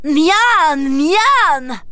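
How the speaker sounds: delighted ogre. Acted. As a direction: savour it like a hungry ogre child. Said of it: "crie Bouli-Bouffe-Tout". (petit ogre gourmand et ravi)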